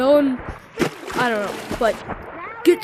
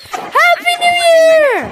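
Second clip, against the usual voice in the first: calling from far away; 11 semitones higher, 4 dB louder than usual. Real, not acted. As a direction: call out across the map. Calling greeting.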